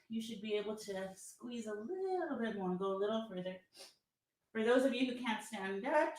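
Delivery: soft, calm stretch instructions; soft stretching guide. Acted. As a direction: guide the stretch gently and quietly. Leads a gentle stretch.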